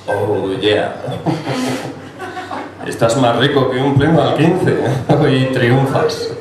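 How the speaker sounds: arranque grave de galán, final alargado; piropéate al espejo, imitando a un ligón; galán presumido imitado. Acted. (imitación leve de guaperas encantado de sí mismo)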